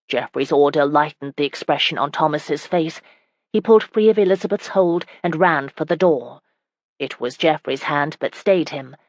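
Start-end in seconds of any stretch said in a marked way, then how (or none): none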